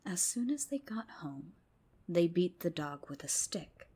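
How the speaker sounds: plain reading voice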